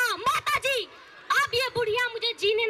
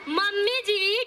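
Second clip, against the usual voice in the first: normal talking voice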